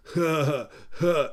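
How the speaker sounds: imitated goofy stoner chuckle; burnout chuckle. Jackass laughs at his own unfunny line, imitated. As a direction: chuckle dopily at your own remark. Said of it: "that burnout chuckle".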